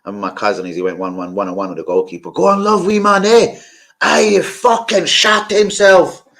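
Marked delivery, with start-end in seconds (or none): 2.3-6.3 high, loud heckle from the stands; retold fan shout. Cousin heckling the player Love, retold fan shout. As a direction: holler it like a cousin at the match. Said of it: "The way he said it".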